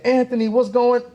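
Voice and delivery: high, loud retold hail; calling out a greeting. Retold greeting called to client.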